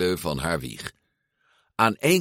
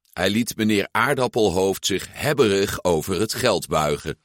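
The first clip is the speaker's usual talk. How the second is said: plain reading voice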